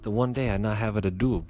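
put-on botched Japanese accent turning Italian; accidental Italian accent. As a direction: slip into a mock Italian accent. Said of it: "Why do I make him sound Italian now?".

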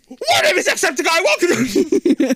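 high, loud hammed-up YouTuber intro; Jacksepticeye impression. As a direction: shout the intro like an overexcited YouTuber. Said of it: "Hook it up to YouTube videos".

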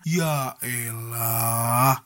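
very slow, low, deflated drawl; drawn-out low groan of disbelief; exasperated. Real, not acted. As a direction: let the words sink in defeat.

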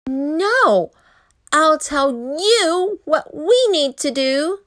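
put-on bossy child. A narrator's reading. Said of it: "Rue said".

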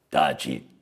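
very low, rough growl; creaky, menacing snarl. A narrator's reading. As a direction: growl the order like a menacing boss. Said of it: "ringhiò Calabrone".

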